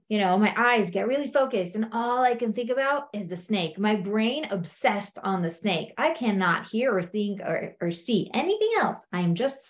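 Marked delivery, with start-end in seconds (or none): none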